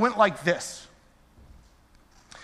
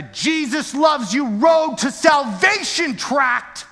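slow, higher, louder outrage; outraged; 8 semitones higher, 5 dB louder, slower than usual. Real, not acted. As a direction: spit out the insult of that tip. Outrage at tract tip.

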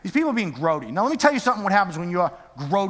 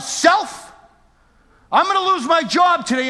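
higher, louder fired-up vow to herself; angry vow; 10 semitones higher, 6 dB louder than usual. Retold or imitated. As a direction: tell yourself you'll risk it all. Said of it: "I said to myself".

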